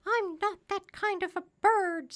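high, loud parrot voice; high-pitched talking bird; indignant parrot. A narrator's reading. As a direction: protest as an offended parrot.

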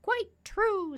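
high, loud parrot voice; high-pitched talking parrot; self-assured parrot. A narrator's reading. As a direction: answer as a confident high-pitched bird.

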